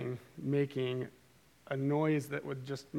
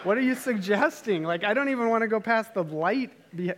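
high, loud, hammed-up disbelief; incredulous protest; 7 semitones higher, 6 dB louder than usual. Dad answers his boys' suggestion, incredulous retold protest. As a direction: balk loudly at the very idea.

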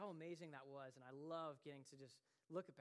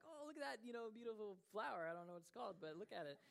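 mock delighted distraction; 6 semitones higher than usual. Faking delight to distract fiancée.

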